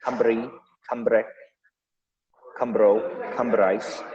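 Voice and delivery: "Cambri, cambre, cambro, cambrais" each with a tapped R; lightly trilled R. Shown as a demonstration. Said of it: "more trilled".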